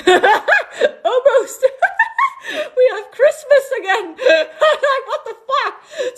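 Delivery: high, loud laughing through the words; laughing disbelief. Real, not acted. Laughing to viewers about Christmas coming, high and giddy.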